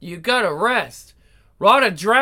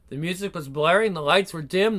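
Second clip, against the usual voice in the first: normal talking voice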